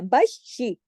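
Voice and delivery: बशी with श sounded twice, firmly; double SH. Shown as a demonstration. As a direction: press into the श of बशी. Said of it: "शीन की आवाज दो दफा आनी चाहिए". (बशी, शीन दो दफा)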